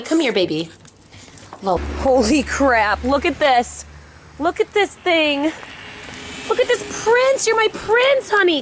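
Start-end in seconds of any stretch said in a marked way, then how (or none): 4.4-8.6 very high doting coo; high-pitched adoring squeal to a dog; gushing pet talk. Real, not acted. Fawning over her dog.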